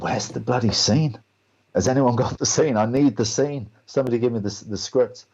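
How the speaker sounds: retold exasperation, insistent and wound up; exasperated demand. Actor in the green room begging for the script, exasperated. Acting out demanding the missing scene.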